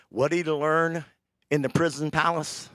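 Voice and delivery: usual speaking voice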